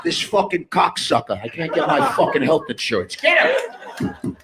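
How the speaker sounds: ranting boss building to a high shout; angry mob boss. Pretend. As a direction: order the hit in a rage. Mob boss orders a hit.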